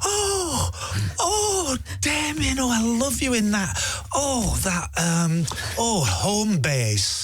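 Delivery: high, tearful gushing fan voice; imitating a weepy older lady fan; gushing older woman fan. Actor imitates a fan who stopped him in the street, high and gushing. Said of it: "this brilliant sort of slightly older woman stopped me in the street, went".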